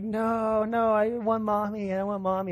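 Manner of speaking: put-on high, drawn-out child's whine; imitated crying toddler. Dad imitating daughter in bed wanting mommy, high tearful whine. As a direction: imitate a little one wailing for a parent. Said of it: "All of a sudden, she stops crying".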